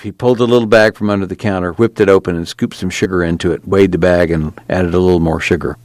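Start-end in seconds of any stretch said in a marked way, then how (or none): none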